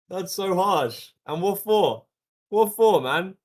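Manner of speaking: loud, high, laughing protest; mock outrage. Streamer jokingly protests a harsh onion gag, loud mock outrage. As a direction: cry foul at full volume, half laughing.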